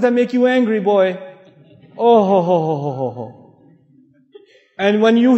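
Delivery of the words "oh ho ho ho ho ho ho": long run of "ho-ho-ho", falling away; loud "oh ho ho" sliding down low; knowing mock groan. Reacting to wives' angering remarks.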